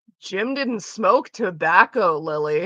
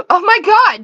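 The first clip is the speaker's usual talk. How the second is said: excited squeal; 11 semitones higher, 11 dB louder than usual. Real, not acted. Surprised at a chat name.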